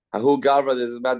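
ordinary talk, nothing marked

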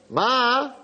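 high, drawn-out "Ma"; teen daughter calling to her mother, acted; calling for Mom.

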